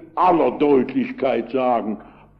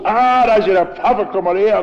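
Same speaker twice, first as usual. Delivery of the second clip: higher, louder retold bellow at the passing pastor; shouting; 4 semitones higher, 4 dB louder than usual. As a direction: call him over across the crowd, loud and high. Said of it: "brüllt los".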